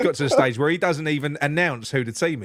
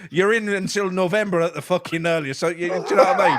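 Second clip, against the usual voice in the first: put-on a lightly mangled Scottish accent, a touch louder; bad Scottish accent; 7 semitones higher, 4 dB louder than usual. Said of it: "Just murdering the Scottish accent".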